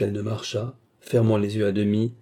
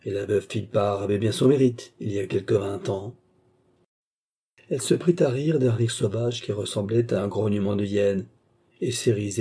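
usual speaking voice

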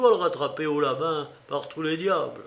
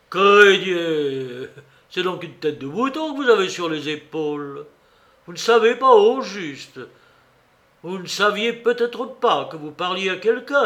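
loud, scornful bullying questions; berating sailor; 4 semitones higher, 6 dB louder than usual. A narrator's reading. Cook berating Morgan in his tavern, loud and scornful. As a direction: tear into him, demanding answers.

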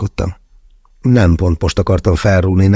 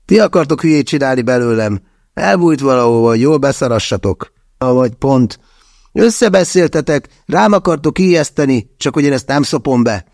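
higher, accusing character voice; on the attack; 6 semitones higher than usual. A narrator's reading. Andrej accuses his friends of a prank, higher and angry. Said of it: "támadásba lendült".

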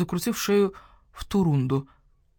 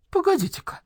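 sudden realization; 12 semitones higher than usual. Acted. Sudden recognition.

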